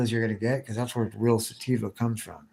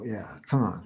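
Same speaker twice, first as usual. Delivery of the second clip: usual speaking voice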